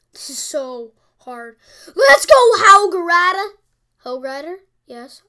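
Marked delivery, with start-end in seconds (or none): 2.0-3.5 loud, high rallying cry; excited cheer. Real, not acted. Gamer cheering his Hog Rider on, excited. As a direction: hype up the card as it drops.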